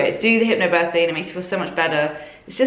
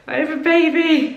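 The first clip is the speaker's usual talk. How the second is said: high, louder squeal of joyful news; excited announcement; 11 semitones higher, 5 dB louder than usual. Retold or imitated. New mother telling her mum, excited high announcement. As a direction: announce it in giddy disbelief, pitched way up.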